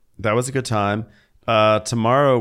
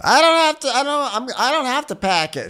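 mock bratty guest; 11 semitones higher than usual. Guest refusing the host, high and whiny. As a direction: whine it like a stubborn guest.

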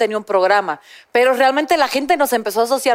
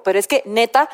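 normal talking voice

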